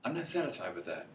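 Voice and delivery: a hint of calm resolve, spoken low and soft; quiet and firm. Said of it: "The graduate class says".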